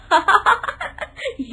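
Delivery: loud laugh breaking out; can't hold the laugh; burst of laughter. Acted. Laugh at bad news. (फूटती हंसी)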